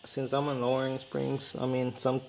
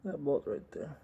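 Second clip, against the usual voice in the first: talking from flat on the back; lying under a car; 2 semitones higher than usual. Real, not acted. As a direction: voice from beneath the car. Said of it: "I'm under the car now".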